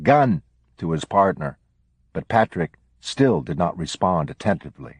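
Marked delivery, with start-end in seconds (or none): 0.0-0.4 short, raised-pitch mild yell; yelled warning. A narrator's reading. Officer warning his partner of a handgun, restrained yell. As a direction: call out an urgent warning, not full volume. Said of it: "and yelled out".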